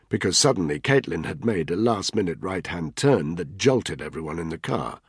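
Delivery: ordinary delivery, nothing marked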